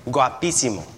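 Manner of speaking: teaching the congregation a Spanish word, said clearly. Shown as a demonstration. Guapísimo, Spanish sounds, stress on pí. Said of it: "I'm gonna teach you all one word in Spanish".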